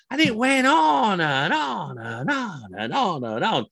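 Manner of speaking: high, slow, drawn-out "on and on"; exasperated sing-song drag; endlessly dragging on. Retold or imitated. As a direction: stretch each "on" in a rolling wave. Mimics how endlessly it dragged on.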